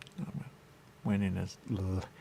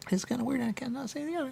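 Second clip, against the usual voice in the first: higher, light voiced reply; quoted reply; 14 semitones higher, faster than usual. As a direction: answer shyly, voice still lifted. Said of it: "I go".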